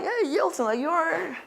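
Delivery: high-pitched imitation of impressed acquaintances; high, soft put-on voice; mock admiration. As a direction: play an admirer, voice lifted high. Imitating admiring people in Panama.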